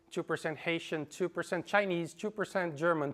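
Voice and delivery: ordinary delivery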